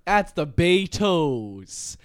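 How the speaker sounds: loud, drawn-out mock Liverpool accent. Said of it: "That's a good Beatles impression".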